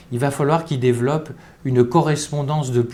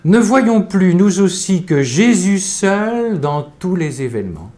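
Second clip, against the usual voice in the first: emphatic reading; 7 semitones higher, 5 dB louder than usual. Retold or imitated. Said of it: "Elle écrit à sœur Céline".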